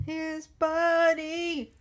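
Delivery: high, drawn-out coo; cooing fondness. Pretend. Co-host gushes over McKay's whale friend returning, cooing fondness. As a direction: stretch it out adoringly.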